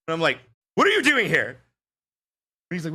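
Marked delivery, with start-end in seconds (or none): 0.8-1.5 high, loud astonished question; retold startled outburst; shocked disbelief. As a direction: blurt it at someone who should be gone. Acting shock at friend's reappearance.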